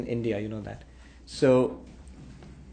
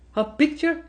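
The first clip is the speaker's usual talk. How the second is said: incredulous echo; 11 semitones higher than usual. Acted. Minister surprised by the prince.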